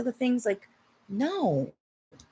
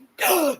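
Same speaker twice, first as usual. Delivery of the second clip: mock gasp; 10 semitones higher than usual.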